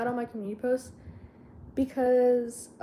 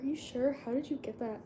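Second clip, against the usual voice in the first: suspicious hush; 9 dB quieter than usual. Retold or imitated. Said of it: "she would've been like".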